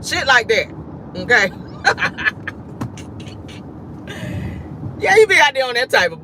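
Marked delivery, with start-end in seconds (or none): 1.6-4.9 long, wheezing laugh; helpless breathy laughing fit; real laugh. Real, not acted. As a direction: crack up and wheeze it out. Cracks up at own joke.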